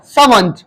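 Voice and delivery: सर्वेंट as SAA-vunt, with silent R; correct servant pronunciation. Shown as a demonstration. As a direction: model सर्वेंट alone, reduced ending -vunt. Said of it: "इसकी सही pronunciation होती है". (सर्वेंट का सही उच्चारण, सा-वंट)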